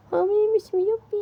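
high and loud happy cheer; overjoyed. Acted. Rose celebrating her pregnancy alone, high joyful cheer. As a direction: squeal it out with delight.

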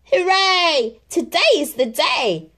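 loud, high joyful cheer. Acted. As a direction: celebrate out loud. Rabbit cheers.

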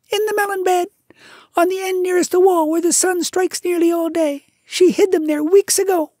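very high, loud chirpy bird voice; a shrill little bird, bursting with triumph; tailorbird. A narrator's reading. Bird tells the mongoose the news, triumphant. Darzee speaking.

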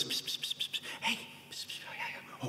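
hushed conspiratorial psst whispers; gossiping whisper. Pretend. Preacher acting out a gossip, whispering.

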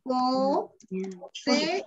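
"Poseer" slowly split into syllables, with pauses between them; separado en sílabas. Shown as a demonstration. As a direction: say "Poseer" as Po... se... er, the two e's apart. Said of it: "son vocales fuertes y se separan". ("Poseer" dicho por sílabas, separando las dos es)

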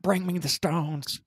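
drawn-out mock southern villain line; hammed-up southern accent impression; southern-tinged accent. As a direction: imitate an over-the-top southern accent, very slow. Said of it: "almost got, like, a s-, a southern accent".

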